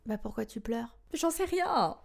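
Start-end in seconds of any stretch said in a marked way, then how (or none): 1.1-2.0 higher, wobbly mock-tearful voice. Pregnant woman asked why she cries, mock tearful. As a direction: play a weepy pregnant woman.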